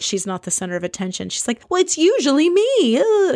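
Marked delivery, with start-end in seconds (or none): 1.7-3.0 much higher, louder, entitled; mock Jill. Mocking Jill's need for attention. 3.0-3.3 disgusted groan. Real, not acted. Speaker's disgust.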